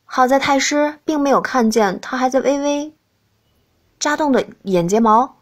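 plain reading voice

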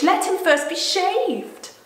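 high, loud, slow gloating witch; high, slow, loud cruel witch; witch's cruel order. Acted. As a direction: savour the humiliation as the witch. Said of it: "said the witch".

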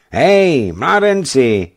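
high, loud and slow call down; loud drawn-out hail; shouted hail. Acted. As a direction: call down from the tower to the boat. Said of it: "vykřikl hejtman".

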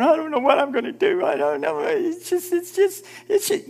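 high, whiny imitation of someone falling apart; hammed-up helpless sobbing voice; mimicking a believer crying over trouble; mock crying. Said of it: "start crying".

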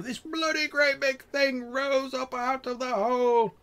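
very high, swelling tall-tale climax; awestruck rustic trapper. Acted. Trapper describing the beast rising from the hole, high rustic amazement. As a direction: push the pitch right up and stretch it out.

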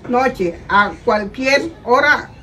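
slow, measured read-aloud. Shown as a demonstration. Mother reading the egg advert again, slow reading. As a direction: read the script out slowly, word by word. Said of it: "léelo lento y mirando la cámara".

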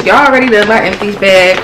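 ordinary talk

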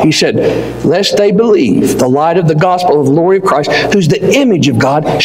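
ordinary delivery

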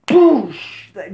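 loud, high "boosh" burst; imitated blast. Storyteller imitates Iron Man's snap, explosive boosh. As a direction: punch out a sudden explosive whoosh.

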